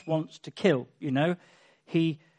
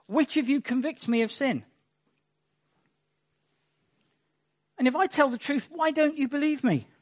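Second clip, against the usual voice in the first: higher, pressing rhetorical questions; pointed challenge; 6 semitones higher than usual. Acted. Jesus asks his accusers why they don't believe: higher, pointed challenge. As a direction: dare them to name a sin.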